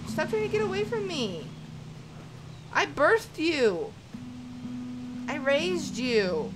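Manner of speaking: indignant parent, dragging out the words; mock-hurt scolding. Game player scolding cubs leaving their mother, mock-hurt.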